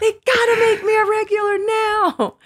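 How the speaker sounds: ecstatic. Retold or imitated. Daughter yells good news to mom from phone booth. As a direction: scream the news with joy, half in tears.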